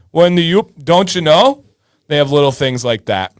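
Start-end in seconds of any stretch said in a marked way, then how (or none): 0.1-1.6 Yooper twang with a rising, questioning end; Yooper accent. Shown as a demonstration. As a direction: lightly imitate an Upper Peninsula accent. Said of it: "like a blend of Canada and America".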